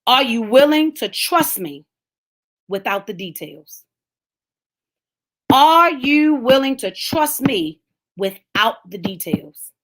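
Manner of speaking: slow, measured, each word weighed; slow solemn question. Acted. God asking the preacher, slow and solemn. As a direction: let God's question hang.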